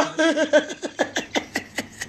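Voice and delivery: long, soft high laugh trailing off. Real, not acted. Woman laughs at her own joke about the police, a long soft laugh. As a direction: let the laugh roll out.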